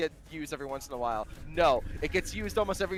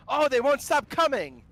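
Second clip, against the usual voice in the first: panicked cry; 9 semitones higher, 6 dB louder than usual. Retold or imitated. Frantic game story moment.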